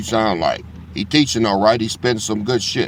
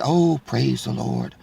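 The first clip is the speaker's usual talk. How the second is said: imitating a pastor; 5 dB quieter than usual. Vlogger mocking the deceiving pastor to his viewers, mock pious. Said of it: "the pastor come at you with".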